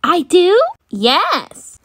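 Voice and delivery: ordinary delivery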